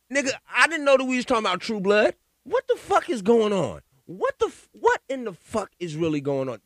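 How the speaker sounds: loud, high and fast, incredulous; outraged disbelief. Real, not acted. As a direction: let the outrage burst out. Outraged rant at the senator.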